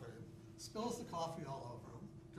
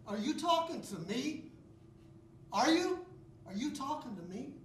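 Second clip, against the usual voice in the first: raised, animated comic voice; Ricky character voice; 9 semitones higher, 4 dB louder than usual. Acted. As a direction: play the bewildered guy at the mirror. Comedy monologue as Ricky.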